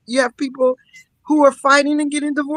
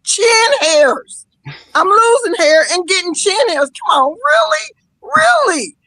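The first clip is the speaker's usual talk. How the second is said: high, loud, incredulous "really?"; exasperated disbelief; 8 semitones higher, 5 dB louder than usual. Real, not acted. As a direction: throw up your hands in disbelief. Exasperated rant about chin hairs.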